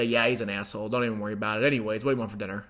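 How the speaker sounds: low, soft offhand reassurance; casual reassurance. Acted. Boyfriend comforting girlfriend after the podcast, casual reassurance. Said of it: "give my girlfriend a hug and say".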